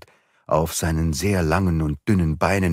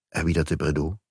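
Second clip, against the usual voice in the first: ordinary delivery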